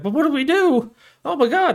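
high, loud hammed-up panic; mock freaking out. Townsfolk facing a man with a hostage, mock freaking out. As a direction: fret loudly like a panicked townsman. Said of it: "They're freaking out".

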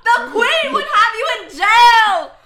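high, loud, drawn-out hammed-up yelling; mock outrage. Outraged Brit scolding someone refusing tea, hammed-up shouting. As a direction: play furious Brit, shouting slowly through laughs.

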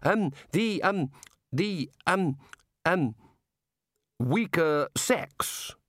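high, halting ums; embarrassed groping. Acted. Wizards struggling to explain women to the god, awkwardly. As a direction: search slowly for the delicate phrase. Wizards grope for polite words.

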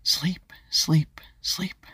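put-on soft, airy little-girl whisper; hushed self-soothing whisper; whisper to herself. A narrator's reading. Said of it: "Ava whispered to herself".

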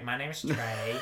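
imitated Southern accent. Guest tries to sound like Southern co-host, mock Southern accent. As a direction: mimic co-host's Southern accent through a laugh. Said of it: "I'm just gonna try to sound like you".

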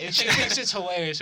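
normal talking voice

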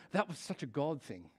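low, understated churchy aside. Retold or imitated. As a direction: lightly imitate a reverent churchgoer, soft and low. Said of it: "noticed how Christians talk like this".

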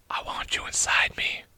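soft, sultry-joke whisper; whispered punchline. Acted. Toaster speaking to a slice of bread, whispered. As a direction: whisper it as an intimate come-on.

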